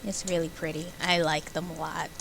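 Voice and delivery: ordinary talk, nothing marked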